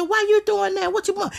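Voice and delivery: put-on begging the girlfriend to stop, squeaky high plea; exasperated high plea. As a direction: push it way up in disbelief. Pleading with girlfriend.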